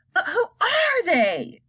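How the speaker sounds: high, slow bewildered cry. Acted. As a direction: cry out in confusion. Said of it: "cried Shanks".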